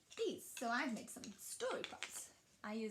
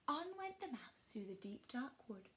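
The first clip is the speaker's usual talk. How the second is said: ordinary delivery, nothing marked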